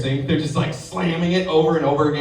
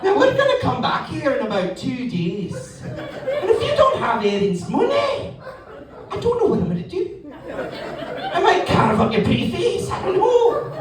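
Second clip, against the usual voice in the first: put-on menacing Scottish child; 14 semitones higher than usual. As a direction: threaten a debtor like a tiny Glasgow thug. Scary kid debt collector threatening.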